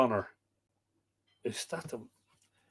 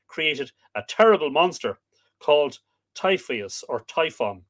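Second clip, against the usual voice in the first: loud, higher, rousing storytelling; dramatic story narration; 5 semitones higher, 6 dB louder than usual. A narrator's reading. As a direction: launch the tale of the monster with gusto. Reads myth narration aloud.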